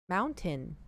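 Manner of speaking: "mountain" with the t fully sounded; spelling it out. Shown as a demonstration. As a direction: say "mountain" as spelt. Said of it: "'Cause it's spelt like".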